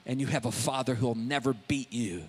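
tender comfort. Retold or imitated. Preacher retelling soothing words to the abused woman. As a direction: speak gently to a hurting woman.

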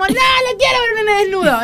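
high, whining mock cry; whiny complaint. Mocking an adult at the pool, high whiny. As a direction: whimper like a fussy adult. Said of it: "pero es como".

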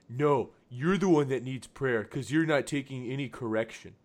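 put-on dork scolding someone; mock a know-it-all commenter talking down; dorky, preachy nerd impression; condescending, dorky voice. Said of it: "most condescending, dorky voice I can".

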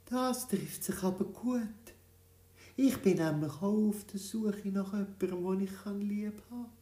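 put-on old woman answers the tiny creature warmly. A narrator's reading. (alte Frau, höher und freundlich)

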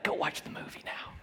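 whispered aside; hushed nudge to go watch. Real, not acted. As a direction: lean in and whisper it. Aside to congregation.

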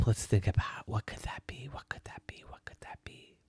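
wondering whisper fading out. Acted. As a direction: think aloud, drifting off. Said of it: "And the star said".

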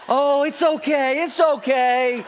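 slow, high, loud soothing coo; comforting. Retold or imitated. Dad soothing his little girl, slow high coo. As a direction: soothe her like a baby, slowly. Comforting daughter after fake spank.

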